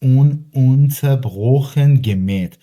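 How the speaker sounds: teacher reading the example sentence slowly to students; slow careful reading. Shown as a demonstration. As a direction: read each word slowly and clearly for learners. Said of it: "نوشته".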